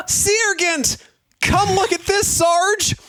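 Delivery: very high-pitched, hammed-up yell; yelled call. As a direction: call the sergeant over in a high character voice. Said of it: "yelled Corporal Vile from a window".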